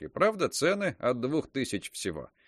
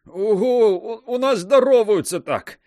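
strained in pain; 10 semitones higher, 9 dB louder than usual. A narrator's reading. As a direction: choke out words through hand pain. Just crushed hand in pain.

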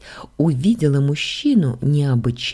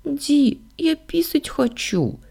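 put-on high, small child voice; hushed, high-pitched little girl; whispering little girl; 12 semitones higher, slower than usual. A narrator's reading. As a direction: plead timidly like a little child. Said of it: "прошептала Сашка".

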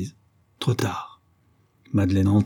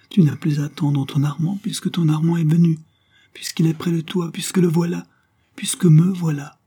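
high, insistent pleading; urgent plea; 8 semitones higher, 3 dB louder than usual. A narrator's reading. Count urges Madeleine to see Armand has come, urgent plea. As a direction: press each reason harder, imploring.

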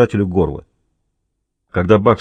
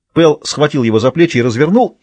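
usual speaking voice

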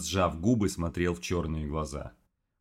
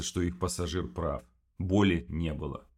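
usual speaking voice

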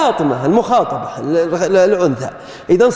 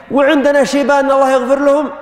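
normal talking voice

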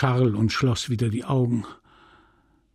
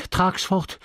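higher-pitched, brisk order; lively, urgent; 6 semitones higher than usual. A narrator's reading. Sick wife wants it carried off, lively. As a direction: order it away with sudden energy. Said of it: "sagte sie lebhaft".

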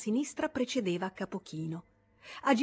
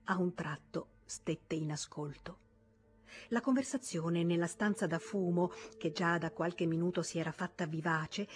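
ordinary delivery

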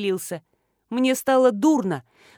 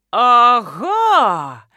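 loud, crowing exclamation; triumphant aha from the colonel; joyful triumph; 8 dB louder than usual. A narrator's reading. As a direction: burst out gleefully, much louder. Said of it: "радостно заявил полковник".

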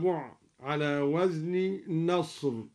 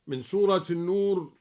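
usual speaking voice

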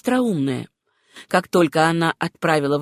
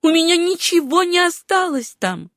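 high-pitched, emphatic declaration; insistent; 10 semitones higher, 3 dB louder than usual. A narrator's reading. Susanna convinces herself nothing remains behind, high and insistent. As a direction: insist on it in a much higher voice. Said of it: "твердила она".

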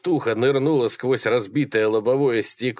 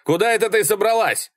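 high, indignant shout; high-pitched exasperated yell; yelling after her; 12 semitones higher, 3 dB louder than usual. A narrator's reading. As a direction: call out sharply to someone leaving. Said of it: "завопил Малькольм".

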